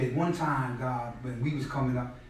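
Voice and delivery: ordinary talk, nothing marked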